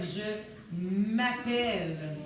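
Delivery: "Je m'appelle" very slow, stretching "m'appelle"; slowed for spelling. Shown as a demonstration. Teacher sounding out "Je m'appelle" slowly for the children. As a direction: draw out "m'appelle" so each sound is heard. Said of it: "c'est un A et un P".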